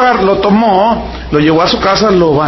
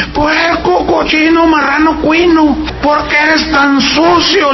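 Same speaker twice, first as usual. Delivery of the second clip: high exasperated scolding of the pig, slower on the question; scolding kid; 8 semitones higher, slower than usual. Retold or imitated. Boy scolding his muddy piglet, high and exasperated. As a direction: scold the dirty piglet like a fed-up child.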